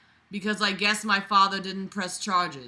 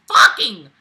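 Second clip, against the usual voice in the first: sudden barked curse; loud outburst; 12 dB louder than usual. Real, not acted. Reader exploding in frustration at the writer. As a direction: spit it out in frustration.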